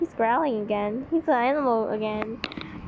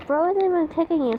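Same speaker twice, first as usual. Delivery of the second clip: loud, fast exasperation; exasperated; 5 semitones higher, 4 dB louder, faster than usual. Real, not acted. Reader exasperated at the crying heroine. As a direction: blurt it out fed up.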